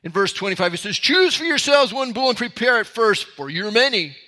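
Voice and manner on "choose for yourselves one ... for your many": high, mocking challenge; sarcastic. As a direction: serve it as a sly taunt. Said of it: "There's an air of sarcasm in Elijah's voice".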